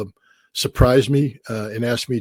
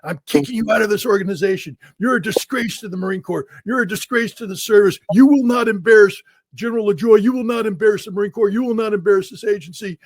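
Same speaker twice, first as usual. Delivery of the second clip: retold tirade, pitched high; imitating a general dressing him down; a general berating a young Marine, mock angry; 9 semitones higher than usual.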